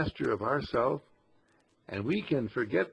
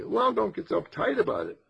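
mock skeptic; 8 semitones higher than usual. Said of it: "they'll say".

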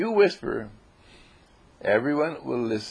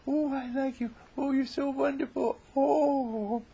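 tiny high, soft cooing voice; squeaky, hushed adoring falsetto; mock lovey-dovey; 14 semitones higher, 5 dB quieter than usual. A narrator's reading. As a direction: coo like a smitten sweetheart, hammed up. Jane imitates loving whisperers.

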